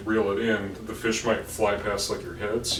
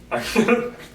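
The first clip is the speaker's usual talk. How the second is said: burst of laughter; 11 semitones higher, 4 dB louder than usual. Real, not acted. Laughs at kicking story.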